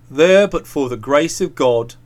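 low, grave tone; deep-pitched solemn reflection. A narrator's reading. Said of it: "he said in a low voice".

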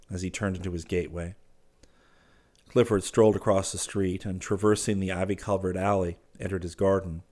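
ordinary delivery, nothing marked

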